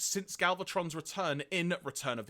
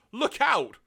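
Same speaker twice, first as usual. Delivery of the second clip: loud, high mock yell; sudden raised shout, imitating a warning; 9 semitones higher, 9 dB louder than usual. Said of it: "as he yells out".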